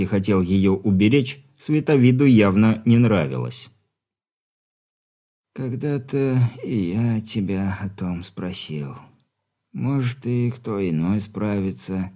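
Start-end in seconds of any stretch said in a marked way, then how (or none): 5.5-12.1 put-on much softer frail old man; weak stern dying father.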